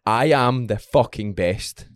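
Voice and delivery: slowed, deliberate affirmation; slow gym self-talk mantra. Retold or imitated. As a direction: drag each word out to psych yourself up. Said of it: "Sometimes I'll just be like".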